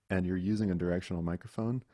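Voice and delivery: ordinary talk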